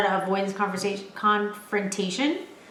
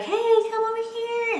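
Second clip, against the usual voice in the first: high, coaxing call to a dog; imitating the girl; 13 semitones higher than usual. Girl calling the runaway dog over, high and coaxing. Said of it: "She's like".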